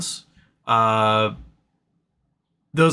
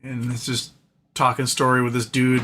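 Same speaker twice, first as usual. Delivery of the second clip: normal talking voice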